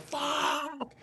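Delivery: high, drawn-out, held-back volume; mock yell. Father reacting to being whacked, mock yell. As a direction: imitate a long howl without shouting. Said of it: "he'll yell".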